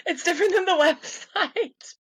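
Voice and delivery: laughing through the words, pitch up; giggling while correcting herself. Real, not acted. Laughs about changed thesis title.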